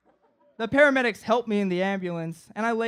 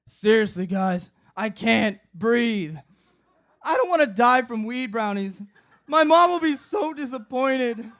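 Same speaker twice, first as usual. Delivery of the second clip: panicked plea; 3 semitones higher, 7 dB louder than usual. Retold or imitated. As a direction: beg like you're dying. Begging friends, fearing death.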